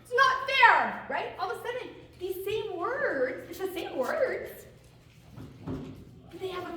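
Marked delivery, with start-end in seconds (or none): 0.0-1.1 loud, high, furious complaint; really mad. Shown as a demonstration. Coach acting the mad version for the class, loud and angry. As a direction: stomp and rage that it's unfair. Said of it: "What if I'm really mad about it?".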